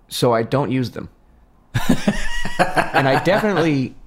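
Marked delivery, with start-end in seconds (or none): 1.7-2.9 high laugh. Real, not acted. Laughs at own confession.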